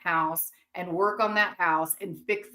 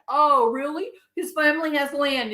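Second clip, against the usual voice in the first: higher, louder sarcastic oh really; mock surprise; 6 semitones higher, 5 dB louder than usual. Vlogger mocking the news of his land with sarcastic surprise. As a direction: act surprised with heavy sarcasm. Mock reaction to new fact.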